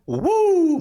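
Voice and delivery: loud high whoop from the heat; excited whoop. Real, not acted. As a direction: whoop as the spice hits. Burning mouth after hot chip.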